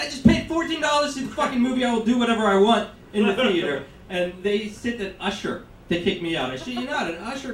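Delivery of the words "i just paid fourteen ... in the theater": indignant yell; angry rant. Retold or imitated. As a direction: shout back, outraged. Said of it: "and I".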